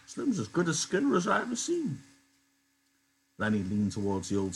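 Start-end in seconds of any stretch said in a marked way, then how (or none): 0.1-2.0 put-on high old-man voice for Candy, a bit embarrassed. Old Candy, embarrassed, to Crooks, high old-man voice. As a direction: lift into a higher old man's voice, shy. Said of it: "Candy quickly changed the subject".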